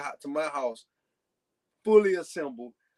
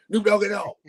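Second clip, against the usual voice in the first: put-on higher, louder call of an old radio friend; imitating Cool Breeze; 6 semitones higher, 7 dB louder than usual. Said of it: "That's how he used to talk to me".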